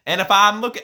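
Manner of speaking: mock southern commentator accent. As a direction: disguise your voice with a southern accent. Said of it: "I was doing a southern accent to, to disguise my voice".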